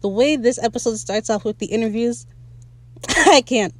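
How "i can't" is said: laughing. Real, not acted. Cracks up over the interviews.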